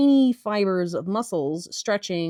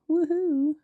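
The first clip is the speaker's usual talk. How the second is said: high playful whoop; 9 semitones higher than usual. Real, not acted. Egging on viewers to enjoy her noises, whooping. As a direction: cheer it out.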